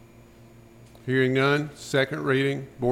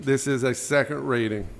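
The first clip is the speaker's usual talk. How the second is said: normal talking voice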